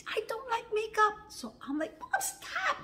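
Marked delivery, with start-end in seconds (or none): 0.1-1.1 high, strained outburst; high-pitched acted anger; retold angry shriek. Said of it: "She was screaming like". 2.0-2.8 very high-pitched wail; high squealed protest; retold pained plea. Re-enacted plea in pain.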